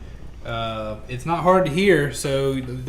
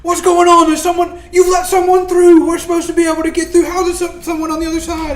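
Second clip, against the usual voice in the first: crowd shouting; 14 semitones higher, 10 dB louder than usual. Acted. GM voices angry crowd shouting.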